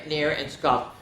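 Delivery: ordinary delivery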